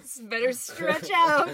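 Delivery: loud, high, slow through laughter; laughing shout. Real, not acted. Teasing a co-host about the handshake arm, loud laughing call. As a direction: call it out while cracking up. Joking along amid shared laughter.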